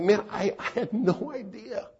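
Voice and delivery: high, loud shocked gasp of words. Retold or imitated. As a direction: sound caught completely off guard. Stunned reaction to friend's words.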